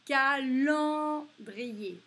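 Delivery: teacher sounding out Calendrier; slow, sounded-out word. Shown as a demonstration. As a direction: stretch Calendrier syllable by syllable. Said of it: "teacher sounding out a word".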